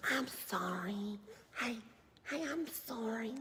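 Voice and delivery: whispered, groveling apology rising high; mock whiny apology. Mocking someone apologizing to a hostile country, whispered. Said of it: "and go".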